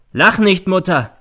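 loud, high harsh command; barking order. A narrator's reading. Son ordering his mother to stop laughing. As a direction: cut her laugh off hard. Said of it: "herrscht sie der Sohn an".

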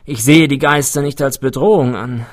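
lively researcher explaining himself; eccentric older scientist, untroubled. A narrator's reading. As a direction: brush off the visitor's fears.

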